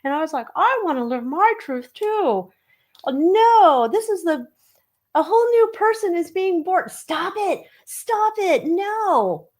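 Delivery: high-pitched, theatrical proclamation; dramatic announcement voice. Acted. Puts on an excited high voice.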